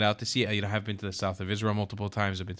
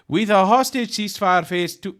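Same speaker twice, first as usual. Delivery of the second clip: reading the headline in a hammed-up Farsi accent, higher and louder; mock Farsi accent; 8 semitones higher, 7 dB louder than usual. Reading news headline to viewers, in a mock Farsi accent. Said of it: "I think that's my Farsi accent".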